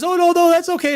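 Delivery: high-pitched imitated "no harm done"; William Shatner. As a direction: wave off an apology in a high voice. Retells star waving off apology.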